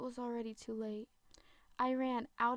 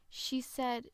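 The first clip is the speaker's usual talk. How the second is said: ordinary delivery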